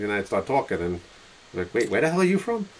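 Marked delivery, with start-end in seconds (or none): 1.7-2.7 high-pitched mock double take; baffled surprise. Stranger hears the cowboy start talking, mock surprised. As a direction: question it as a thrown stranger. Acting out strangers hearing the accent.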